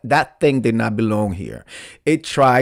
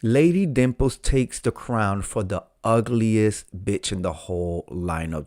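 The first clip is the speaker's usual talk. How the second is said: normal talking voice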